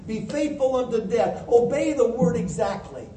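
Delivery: ordinary delivery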